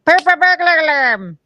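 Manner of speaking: hammed-up leprechaun flourish. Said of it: "That's right".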